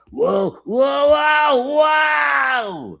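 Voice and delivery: high, loud cartoon exclamation; mock Shakespearean line. Retelling his cartoon lines, high loud whoa. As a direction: belt it like a startled Ewok. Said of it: "such Shakespearean lines like".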